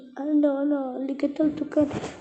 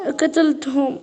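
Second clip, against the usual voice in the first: ordinary delivery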